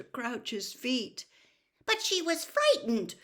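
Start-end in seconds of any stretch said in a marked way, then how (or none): 1.8-3.2 high, loud indignant burst; angry outburst. A narrator's reading. Hermione defending the elf to Mister Crouch, angry outburst. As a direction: burst out at him. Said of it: "Hermione burst out angrily".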